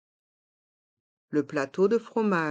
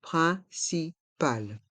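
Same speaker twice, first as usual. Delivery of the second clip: "Principal" split slowly into three syllables; slow model pronunciation; much slower than usual. Shown as a demonstration. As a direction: pause between syllables, clear nasal in of "Principal". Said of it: "Il faut bien prononcer".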